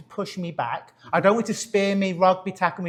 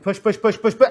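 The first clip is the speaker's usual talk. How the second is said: coaching chant growing louder; urging push; 4 semitones higher, 6 dB louder than usual. Real, not acted. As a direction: push repeated, building louder. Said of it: "just push me back".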